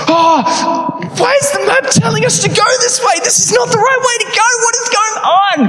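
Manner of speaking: very high stressed-out squawk, fast in places; mock freak-out, voice shooting way up; retold yelling. Driver ranting at the map, high-pitched mock yelling. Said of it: "yelling at me".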